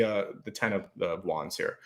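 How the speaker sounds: words fading as the voice drops away; voice giving out. Real, not acted. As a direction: voice briefly gone, straining. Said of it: "Sorry, I lost my voice for a second".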